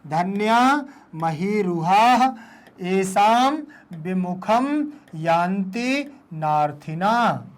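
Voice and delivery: slow, each word drawn out and separate; slow word-by-word reading. Shown as a demonstration. Teacher asks pupils to say it, slow word by word. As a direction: leave a gap after each word for repeating. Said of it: "बोले".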